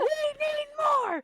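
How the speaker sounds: high fangirl cry; excited wail. Real, not acted. Fangirling about the cut scene.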